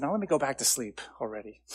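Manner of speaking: quiet, quick mock-weary aside; mock sleepy grumble. Jesus after stilling the storm, a soft joking grumble. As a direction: pretend Jesus wants his nap back. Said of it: "And I imagine said".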